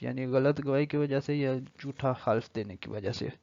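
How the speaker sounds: ordinary talk, nothing marked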